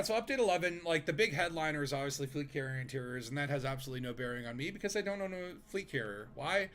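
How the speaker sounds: ordinary talk, nothing marked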